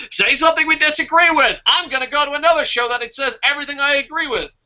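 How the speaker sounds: high whiny viewer voice, quick; mock offended viewer. Viewer quitting the show in a huff, high whiny mock. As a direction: whine like an upset fan leaving. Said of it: "there goes our viewers".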